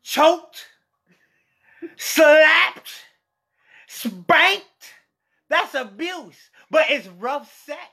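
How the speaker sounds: high, loud worked-up questions; rattling off the list, all fired up; excited. Real, not acted. Excited rant about abuse.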